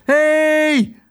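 very high, drawn-out shout; long, high holler for attention; yelling. A narrator's reading. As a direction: yell after someone far away. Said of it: "schreeuwde Tos".

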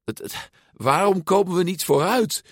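high, stammering desperate question. A narrator's reading. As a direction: stammer helplessly, pitch climbing. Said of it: "vroeg Luca radeloos". (radeloos, hoog stamelend)

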